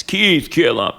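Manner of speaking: high, loud sideline scream; yell from the stands. Retold or imitated. Mom yelling from the stands at her son's football game, loud high yell. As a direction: holler it across a football field. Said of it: "She would yell out from the stands".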